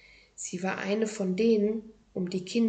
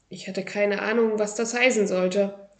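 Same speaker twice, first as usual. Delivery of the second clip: ordinary delivery, nothing marked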